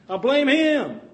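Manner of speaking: hammed-up indignant excuse; mock indignant excuse. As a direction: blame him like a caught child. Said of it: "I'm going to say".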